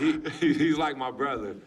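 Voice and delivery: laughing while praising his best friend; laughing fondly. Acted. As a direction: laugh it out about your buddy.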